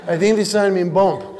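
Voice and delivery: lightly voiced German-ish tourist accent, "Franz" with a nasal a; a hint of a confused German driver; foreign tourist accent. Comic playing a confused foreign driver with a light German accent. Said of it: "poor foreign drivers".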